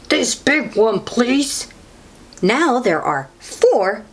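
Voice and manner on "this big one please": goose voice. A narrator's reading. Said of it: "says the goose".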